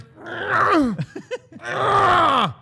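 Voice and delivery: very high, loud imitated heaving; mock retching. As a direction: act out violent heaving noises. People hearing him be sick.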